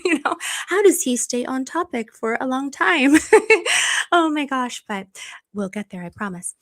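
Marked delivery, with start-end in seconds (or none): 3.2-4.1 high bright giggle; self-conscious laughing burst. Real, not acted. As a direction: laugh at yourself. Laughs at herself.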